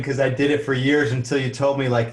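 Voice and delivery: real chuckle breaking out at the end; amused, laughing. Real, not acted. Host admitting an old mistake to the guest, amused and laughing. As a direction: talk through amusement, then laugh. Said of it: "I'm laughing".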